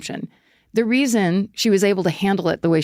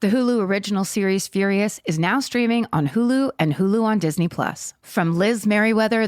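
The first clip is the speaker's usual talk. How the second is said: upbeat sponsor read. Acted. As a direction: sell it like a radio spot. Reads sponsor ad.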